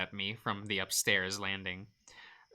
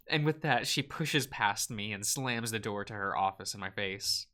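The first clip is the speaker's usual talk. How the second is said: normal talking voice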